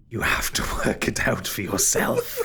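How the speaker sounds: breathy, menacing villain voice; ghostly villain. Acted. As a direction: answer as a cryptic spirit, half-whispered. Game master voices Derethor.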